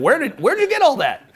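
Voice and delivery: high, amazed question; amazed passengers. Retold or imitated. Fellow passengers amazed at the free stuff, high. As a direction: gasp in amazement at the haul. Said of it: "People are like".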